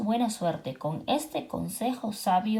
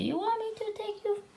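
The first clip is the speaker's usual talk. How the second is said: friendly truck; 11 semitones higher, faster than usual. Acted. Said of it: "Azulito said".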